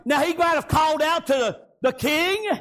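usual speaking voice

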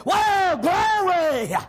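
high, stretched glory shout; preacher acting out a joyful holler; exultant shout. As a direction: shout it out in praise, holding each word. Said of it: "Oh, John, I believe they said".

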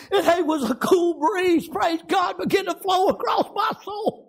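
high, tearful preaching voice; choked-up testimony. Real, not acted. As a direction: testify while overcome with real emotion. Choked up recalling the answer.

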